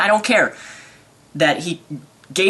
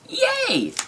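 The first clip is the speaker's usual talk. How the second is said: a squeal of real delight, much higher; thrilled little cheer; excited yay; 4 dB quieter than usual. Real, not acted. Hearing mom brings Taco Bell, a high excited squeal.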